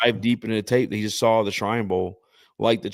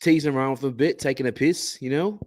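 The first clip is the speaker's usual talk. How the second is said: higher-pitched accent attempt, drifting British; British-sounding Australian attempt; 6 semitones higher, 4 dB louder than usual. Shown as a demonstration. Attempting the accent for friends, comes out British. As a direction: try Australian and land on British. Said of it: "that's British".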